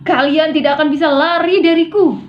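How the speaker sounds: loud, high-pitched threat. Shown as a demonstration. Warning them they cannot run from you, shouting angrily. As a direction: shout it after them in anger. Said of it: "nada yang ditinggikan".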